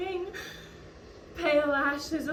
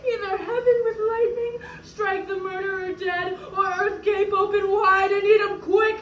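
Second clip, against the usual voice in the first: high, sobbing cry; tearful, impassioned wailing; anguished plea for vengeance; 7 semitones higher, 6 dB louder than usual. Acted. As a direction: cry to heaven, swelling into a shout. Widow calls down vengeance, weeping.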